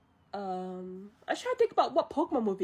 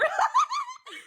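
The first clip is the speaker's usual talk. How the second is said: high squealing laugh; shrieking laugh; 3 dB louder than usual. Real, not acted. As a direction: burst into a shrill giggle. Laughs after grandma line.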